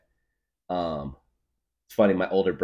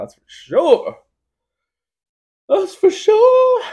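much higher, louder, then slower squeal; squeaky high joke voice; 13 dB louder, slower than usual. Retold or imitated. Joking to a friend about losing feet, a high squeal.

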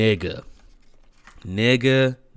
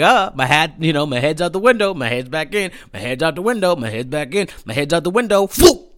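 higher, quick mocking singsong ending in a loud whoop; mimicking the hat; 6 semitones higher, 4 dB louder, faster than usual. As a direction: tease him for bobbing his head out.

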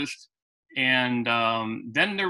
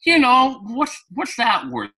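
high, slow disbelieving question; incredulous; 10 semitones higher, 5 dB louder, slower than usual. Real, not acted. Asking what a bumper is worth, incredulous. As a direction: ask it like it's absurd.

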